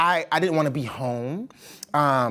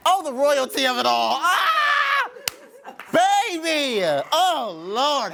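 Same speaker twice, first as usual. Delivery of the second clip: overwhelmed joy; 9 semitones higher, slower than usual. Real, not acted. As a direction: shout it out, thrilled. Overwhelmed after hearing the song.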